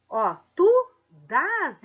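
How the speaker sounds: "dás" drawn out slow and loud; dragging out "dás" to show the accent; stress on dás. Shown as a demonstration. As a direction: lean hard on "dás", the stressed vowel. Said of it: "eu preciso dar entonação na minha voz".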